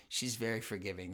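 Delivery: usual speaking voice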